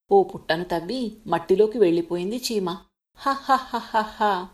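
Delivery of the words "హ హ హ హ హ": slow, drawn-out ha ha ha laugh; the big elephant chuckling with scorn; mocking laugh. A narrator's reading. Elephant laughing at the ant hiding in its hole, slow and mocking.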